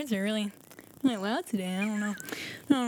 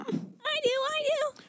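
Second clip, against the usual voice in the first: much higher, squeaky; much slower than usual. Acted. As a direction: squeak like an eager kid. High eager student.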